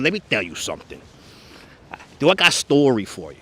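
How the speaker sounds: put-on Brooklyn accent, understated; Josephine. Imitating Josephine from Lenny's, Brooklyn accent. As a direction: lightly imitate a Brooklyn storyteller. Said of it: "Josephine, that's another one".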